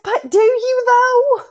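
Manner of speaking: very high, loud comic questioning; mock-skeptical retort. Mom answering her clingy daughter, mock-skeptical. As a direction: squeal the doubt back, hammed-up.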